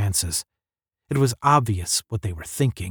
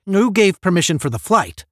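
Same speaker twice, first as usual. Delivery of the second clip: sharp official cutting in, higher; crisp demand; 7 semitones higher, 4 dB louder than usual. A narrator's reading. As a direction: snap out the question. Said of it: "Saunders cut in crisply".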